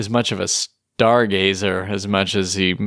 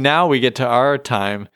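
usual speaking voice

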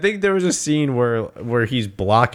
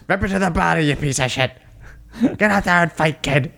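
higher-pitched cornerman egging on his fighter; mock trainer's order, pitched up; Mickey impression; 4 semitones higher than usual. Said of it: "And Mickey's like".

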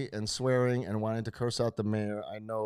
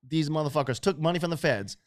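usual speaking voice

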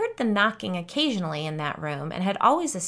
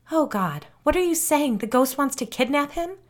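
higher, louder alarmed questioning; panicked; 8 semitones higher, 4 dB louder than usual. A narrator's reading. Frightened mother learning a ghost wants her son, panicked. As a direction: voice climbing in disbelief and dread. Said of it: "Alicia stammered nonsensically".

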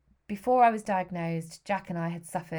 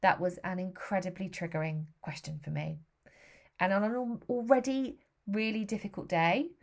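ordinary delivery

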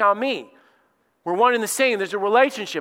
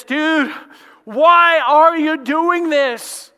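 loud, slow, pitched-up cry of disbelief; Saul's cry to Jesus; 8 semitones higher, 7 dB louder, much slower than usual. Acted. Saul on the road, calling up to Jesus, stunned loud cry. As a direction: stretch it out in a stunned shout. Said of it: "and he says".

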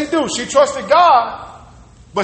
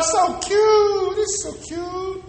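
high cooing, fading soft; Pharaoh's daughter; 7 semitones higher, 5 dB quieter, much slower than usual. Retold or imitated. Pharaoh's daughter gushing over baby Moses, high cooing.

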